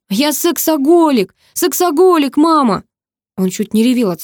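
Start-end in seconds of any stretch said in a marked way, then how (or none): 0.1-2.8 high, slowed exasperated shout; snarled confession. A narrator's reading. Brother snarls his confession to mother, high exasperated shout. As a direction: shout it out in angry desperation. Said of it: "Брат зарычал".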